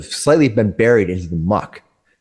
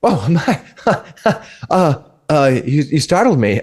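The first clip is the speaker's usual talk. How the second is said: flustered woman; 5 semitones higher than usual. Acted.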